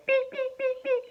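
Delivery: high squeaky bat beeps. Pretend. Playing a bat for his kid, squeaky high beeps. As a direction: chirp like a little bat. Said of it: "'cause you're a bat".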